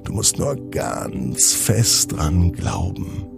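plain reading voice

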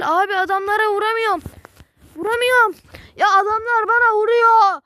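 frustrated yelling. Real, not acted. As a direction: yell at the game, exasperated. Losing fight, yelling at game.

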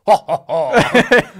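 loud, high, drawn-out "ha, ha, ha"; slow mock laugh from a giant statue; Statue of Liberty laugh. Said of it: "sometimes she goes".

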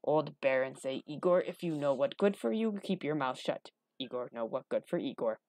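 low gruff voice, sinking at the end; simple-minded Igor, rough and wary; secretive hunchback Igor. Acted. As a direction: confide the warning in broken speech. Igor quotes the Baron's warning.

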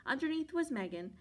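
ordinary delivery, nothing marked

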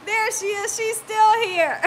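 excited. Real, not acted. Viewer still in chat.

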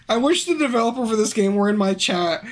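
usual speaking voice

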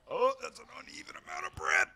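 hammed-up squeal of offence; mock outrage. Streamer mock-outraged at uneven bread on the shelf. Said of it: "I'm really mad about that".

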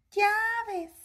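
high squeaky puppet voice; Osito. Pretend. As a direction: repeat it as a little toy bear. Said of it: "¿Lo puedes decir, Osito?". (voz aguda de osito de juguete)

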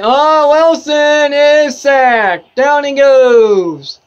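loud, high, drawn-out exclamation; excited sack call. Real, not acted. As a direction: stretch out the cry as the play happens. Calling a sack while watching live.

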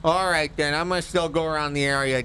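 a cheerful big oaf checking in; a hint of the high oaf voice; Jay Bergerman voice.

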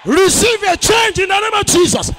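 high, booming shouted command to the congregation; shouted pulpit proclamation; full-force preaching. Real, not acted. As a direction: thunder out a prophetic declaration, drawing out the words.

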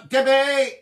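loud, high, drawn-out name call; excited greeting. Real, not acted. Greeting a viewer on her birthday, excited. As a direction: sing out the name in delight.

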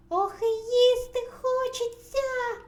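very high, loud squeal; squeaking mouse. A narrator's reading. Second mouse wants food at table, squeaking. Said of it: "пищить Верть".

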